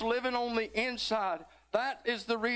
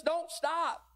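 witnesses cheering; 9 semitones higher than usual. Acted. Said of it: "rooting us on, telling us".

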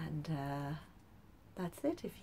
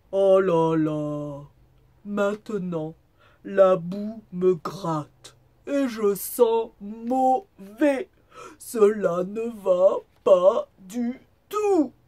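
loud, slow groaning complaint from the wolf; hammed-up, long-suffering dismay; sighing lament; 4 semitones higher, 18 dB louder, slower than usual. A narrator's reading. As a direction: bemoan it wearily, drawing the words out. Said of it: "et soupira".

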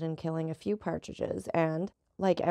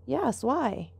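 higher, lightly amused echo of the question; smiling; 6 semitones higher than usual. A narrator's reading. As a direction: toss the question back with a smile. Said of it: "said Gaetano with a smile".

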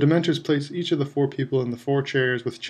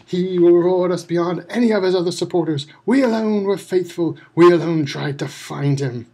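higher, louder fanatical vow; defiant proud call; 5 semitones higher, 5 dB louder than usual. A narrator's reading. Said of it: "looked up at Crouch and called".

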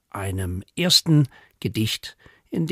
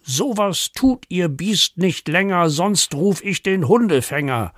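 higher, indignant threat; mock-angry neighbour; 6 semitones higher than usual. Neighbour threatens to call the dogcatcher, mock anger. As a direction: threaten with theatrical annoyance.

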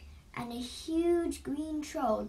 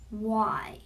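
mildly angry, loud demand; angry troll; 3 semitones lower, 5 dB louder than usual. A narrator's reading. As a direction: demand it with a touch of anger. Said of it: "the troll asked angrily".

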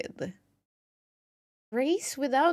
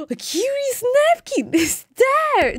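loud, high gasp of delight; excited gasp; 9 semitones higher, 8 dB louder than usual. Real, not acted. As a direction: gasp then announce the find with glee. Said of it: "That voice!".

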